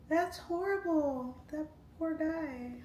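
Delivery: high, slow, pitying cry of horror; mock horror. Telling her chat her reaction, high slow mock horror. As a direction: clutch your pearls and wail it high and slow. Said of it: "I was like".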